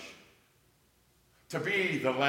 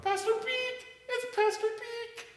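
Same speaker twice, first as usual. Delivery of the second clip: put-on much higher, squeaky little-girl voice, the second one drawn out; imitating a young girl; slower than usual. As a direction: mimic an excited kid calling the pastor's name. Young girl greeting the pastor.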